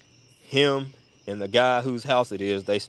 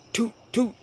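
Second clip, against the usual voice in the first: mock gunshots; 12 semitones higher than usual. Imitating gunshots.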